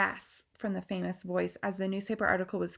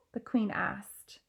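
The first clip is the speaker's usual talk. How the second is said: ordinary delivery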